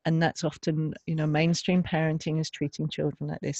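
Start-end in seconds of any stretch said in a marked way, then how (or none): none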